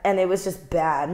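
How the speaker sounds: ordinary delivery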